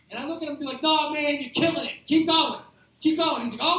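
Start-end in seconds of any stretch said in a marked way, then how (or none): 0.8-3.5 loud, higher-pitched egging on; hearty encouragement. Acted. Prankster urges coworker to keep smashing tomatoes, hearty encouragement. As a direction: cheer him on with mock sincerity. Prankster eggs on the ketchup victim.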